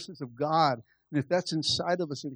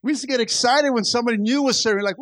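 ordinary talk

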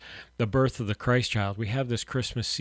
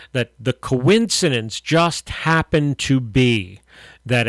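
rising, louder preaching build; 5 dB louder than usual. Acted. Radio pastor preaching to listeners, louder and fervent. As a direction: drive the sermon home with fervour.

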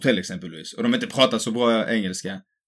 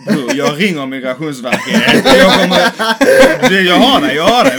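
high, loud, laughing through the joke; hammed-up joke, cracking up; laughing mock threat; 6 semitones higher, 10 dB louder, slower than usual. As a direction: squeal the line out between laughs. Laughing impression of joking friend.

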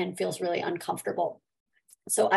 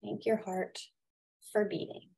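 guided meditation; 5 dB quieter than usual. Acted. Leads a gratitude meditation.

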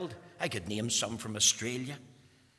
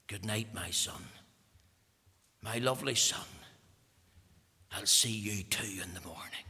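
lower, softer last words; faint dying father; 4 semitones lower, 3 dB quieter than usual. Acted. Dying father bidding son good night, lower and softer. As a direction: fade out like a final breath. Dad drew his dying breath.

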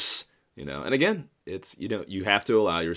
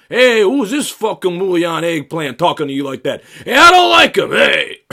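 high, loud mock tough guy; hammed-up goon threatening; gangster bodyguards; 13 semitones higher, 16 dB louder than usual. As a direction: bodyguards shouting at a stranger. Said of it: "Italian gangsters just be like".

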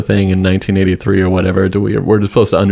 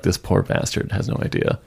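hushed whisper, barely voiced; whispered aside; 2 semitones lower, 9 dB quieter than usual. Whispering to the listeners about the doomed character, hushed. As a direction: breathe it out like a secret pity.

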